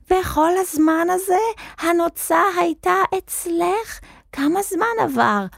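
high, strained cry without real volume; mounting shout. A narrator's reading. Wizard interrogating the hen about the feather, shouting. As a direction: demand answers in a high, shouting voice. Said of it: "בצעקה הולכת וגוברת המשיך".

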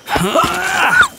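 loud high startled gasp; vampire lunging in for the bite with a loud gasp; sharp gasp. Acted. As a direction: gasp as the fangs come out. Vampire lunges, hunter startled.

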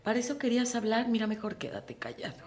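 fast, then soft contemptuous put-down; dismissive partner. Retold or imitated. Speaker voicing a partner who dismisses opinions, contemptuous. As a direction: sneer and cut them off. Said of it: "desestima tus opiniones y te critica".

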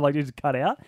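ordinary talk

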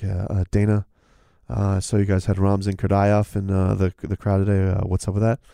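a hint of a droning press reporter; journalist voice.

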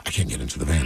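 very low, quiet, scared; hushed paranoid refusal; quiet scared wife. Retold or imitated. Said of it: "she's like".

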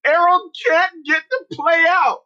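high-pitched call across the field; player's yell. Retold or imitated. Player yells to coach about stuck teammate, high holler. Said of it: "One of the players yelled".

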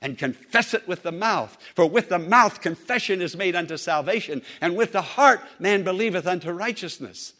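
loud, rising sermon voice; preaching at full force. Acted. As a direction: proclaim it to the congregation.

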